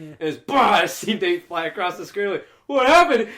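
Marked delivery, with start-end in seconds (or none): 2.7-3.3 high, loud, startled what happened; startled shout. Retold or imitated. Friend blown away by the gadget, loud startled shout. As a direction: cry out in shock.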